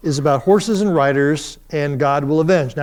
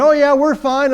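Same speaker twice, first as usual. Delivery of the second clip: higher, louder, breezy lie; cousin's fake cheer; 9 semitones higher, 5 dB louder than usual. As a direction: cover it up like a grinning bully.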